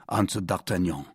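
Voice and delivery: ordinary delivery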